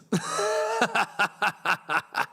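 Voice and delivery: long incredulous laugh; amused laugh. Real, not acted. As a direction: laugh out at the joke. Reacting to a brutal comment.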